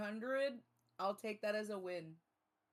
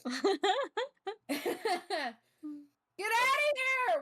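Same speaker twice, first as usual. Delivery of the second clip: laughing shout; 12 semitones higher, 7 dB louder than usual. Real, not acted. Laughing at chat.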